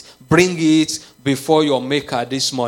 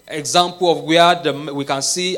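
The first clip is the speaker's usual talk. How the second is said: normal talking voice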